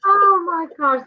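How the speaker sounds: gasping through laughter; laughing. Real, not acted. Friend laughing at the kid's line, through laughter. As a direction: laugh out the words, high and loud.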